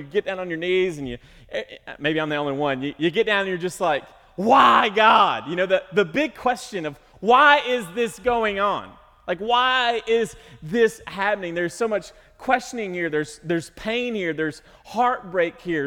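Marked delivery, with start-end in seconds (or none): 4.4-5.5 loud high cry of why to God; desperate yelled why; anguished shout. Acted. Preacher voices anguished cry to God. 7.2-11.5 loud, high, slow cry of why; drawn-out anguished questions; pleading questions. Acted. Preacher voices questioning God.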